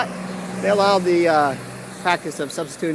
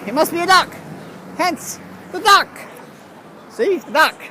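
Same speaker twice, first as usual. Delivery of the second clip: squeaky duck punchline; 12 semitones higher, 4 dB louder than usual. Acted. Rambling vlogger squeaks the duck punchline in a cartoon voice. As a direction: play the duck gag in a silly high voice.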